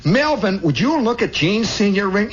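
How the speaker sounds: high insistent lady calling; put-on high motherly voice; neighbor lady. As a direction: summon your kid to admire it. Neighbor calls Melvin to look.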